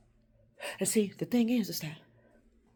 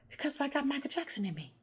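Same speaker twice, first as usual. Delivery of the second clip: ordinary talk, nothing marked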